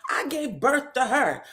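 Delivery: hammed-up shrieking mother, laughing; retelling the mom's scream at her daughter; mock scream. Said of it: "screams out".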